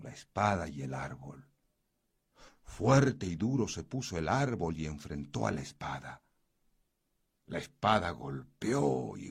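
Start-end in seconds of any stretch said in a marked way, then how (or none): none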